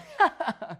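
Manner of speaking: high chuckling laugh. Real, not acted. Teacher laughs after moving on, high chuckle.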